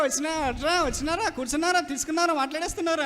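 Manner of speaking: high, rattled-off Visakhapatnam dialect; mimicked Visakhapatnam chatter. Preacher mimicking Visakhapatnam passengers' hurried chatter, sing-song dialect. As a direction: imitate bobbing, sing-song Visakhapatnam questions. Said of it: "చాలా వేగంగా వేగంగా మాట్లాడేస్తారుగా".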